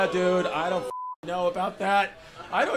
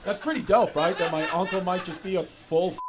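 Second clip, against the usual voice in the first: ordinary talk, nothing marked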